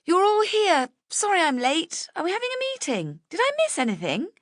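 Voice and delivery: high, fast and bubbly; energetic friend apologising while arriving, bubbly and high; bubbly late arrival. A narrator's reading. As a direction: fill the room with energy.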